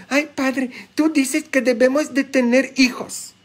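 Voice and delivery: high-pitched mock protest to the priest; put-on high complaining voice; mimicked complaining parishioner. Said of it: "Cuántas vienen conmigo y dicen".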